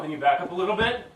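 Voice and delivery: usual speaking voice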